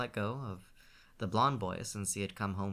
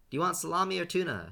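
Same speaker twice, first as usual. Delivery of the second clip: higher and louder, calling from the kitchen; yelled back; 9 semitones higher, 7 dB louder than usual. A narrator's reading. Shane yells from the kitchen to his brother. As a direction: shout it back through the open door. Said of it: "Shane yelled back".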